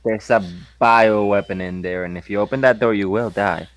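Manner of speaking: ordinary talk, nothing marked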